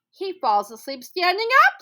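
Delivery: high, loud mocking jeer; mocking lion. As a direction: sneer it out as the lion. Lion mocks Anansi.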